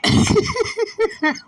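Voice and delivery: high burst of laughter; amused laugh. Real, not acted. Floridian laughing at the rapper's talk, high amused laugh.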